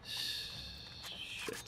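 long, soft voiceless curse; whispered curse. Real, not acted. Player hit by a mishap, slow whispered curse. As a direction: let the swear seep out in a drawn whisper. Mishap while sneaking in game.

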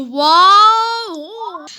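words ringing with echo. Real, not acted. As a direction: voice echoing in a big room. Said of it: "sesim yankılanıyor".

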